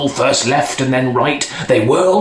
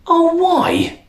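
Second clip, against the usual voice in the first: put-on very high, whiny child; prince crying out. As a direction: wail like a disappointed little boy. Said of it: "the prince cried".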